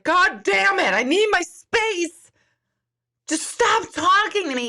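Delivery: hammed-up fed-up snap; imitating snapping. Mom telling family to leave her alone, mock exasperated outburst.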